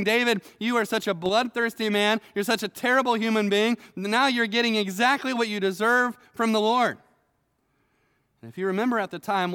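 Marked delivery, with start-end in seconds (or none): 0.0-7.0 raised, louder mock curse at David; cursing quote. Shimei curses David from the ridge, mock angry. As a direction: play the sneering man hurling insults. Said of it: "He's saying".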